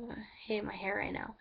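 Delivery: unvoiced whispered aside; hushed whisper about her hair. Real, not acted. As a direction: whisper it like a confession.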